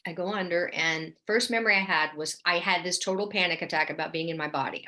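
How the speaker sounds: ordinary talk, nothing marked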